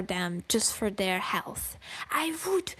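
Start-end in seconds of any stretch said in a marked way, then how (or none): 2.1-2.7 high, intense whisper. Real, not acted. Streamer whispering the build-up. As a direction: hush it before the punchline. Exaggerated rant.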